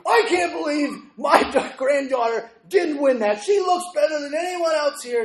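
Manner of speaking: high, loud mock tirade; acted outraged complaint. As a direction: imitate someone storming out in a loud huff. Storming out, angry complaint acted.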